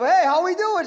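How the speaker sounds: calling the friend during the hurricane, cheerful and high; cheery gloat. Retold or imitated. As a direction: chirp it like you're rubbing it in.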